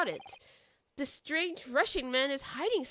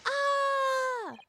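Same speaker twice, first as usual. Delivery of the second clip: high-pitched scream; 13 semitones higher, 3 dB louder than usual. Acted. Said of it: "Such a high-pitched scream".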